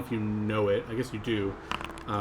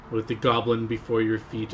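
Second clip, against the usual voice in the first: ordinary talk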